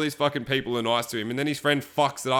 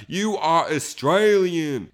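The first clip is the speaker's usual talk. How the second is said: slow deadpan; 3 semitones higher, 4 dB louder, much slower than usual. Retold or imitated. It's like.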